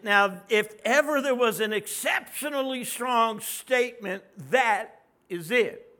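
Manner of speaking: loud emphatic preaching. Acted. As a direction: drive the point home loudly. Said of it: "preaching at full force".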